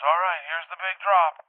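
high, loud, slow announcement; calling out. Retold or imitated. Pilot warning dreamer of the drop, calling out. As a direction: announce it over the helicopter.

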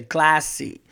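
a light Mauricio take on "classy", stretched and proud; Mauricio's classy. Retold or imitated. Comedian quoting Mauricio's catchphrase, lightly imitated. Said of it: "Mauricio would say".

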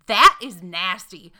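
slow, loud Greensboro North Carolina "That is nasty"; imitating the disgusted counter girl in a Greensboro accent. Retelling the counter girl's reaction, slow and loud Greensboro accent. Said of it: "That's the accent of Greensboro".

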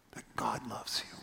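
soft whisper to the dying; slow hushed reassurance. Retold or imitated. Speaking to dying woman.

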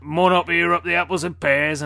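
loud mock Cockney rhyming slang. Mocking a comic's fake Cockney hero, loud Cockney. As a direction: belt it out like a stage Cockney. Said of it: "talking in, like, Cockney rhyme".

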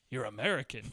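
put-on low, soft line with a faint British accent. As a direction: imitate a very slight British accent. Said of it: "he says it like that. He says it like".

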